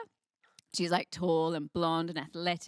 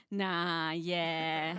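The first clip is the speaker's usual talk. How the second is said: laid-back mock Australian; casual Aussie shrug of a reply; Australian accent; 4 semitones lower than usual. Comic imitating an Australian for the audience, Australian accent. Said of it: "an Australian would say".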